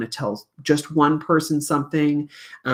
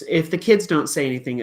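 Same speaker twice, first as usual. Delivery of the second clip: ordinary talk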